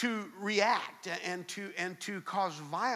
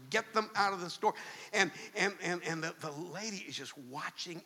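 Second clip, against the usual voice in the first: ordinary talk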